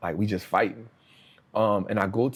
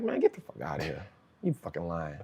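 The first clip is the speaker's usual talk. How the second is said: scoffing disbelief; 6 semitones lower, 7 dB quieter than usual. Retold or imitated. Disbelief at cellmate's claim.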